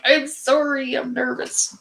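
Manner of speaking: playing her nervous first-time self; mock nervous apology. Teacher retelling her first livestream, sheepish mock apology.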